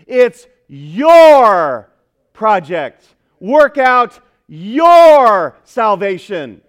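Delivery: "your" shouted and stretched long; emphatic YOUR. Real, not acted. Preacher driving home personal responsibility, "your" hugely emphatic. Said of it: "That's why I made it emphatic".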